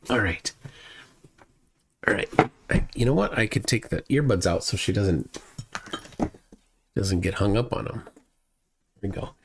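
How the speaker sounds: normal talking voice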